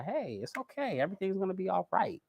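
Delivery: soft, near-whispered comfort; quiet reassuring voice; soothing. Pretend. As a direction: hush them gently like consoling a friend. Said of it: "I gotta turn into a dove".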